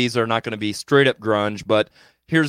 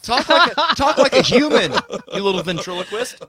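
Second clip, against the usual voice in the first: higher, louder, laughing mock squeal; mock high squeaky voice; 9 semitones higher, 6 dB louder than usual. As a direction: squeak it out mockingly while laughing. Mocking a mumbling player.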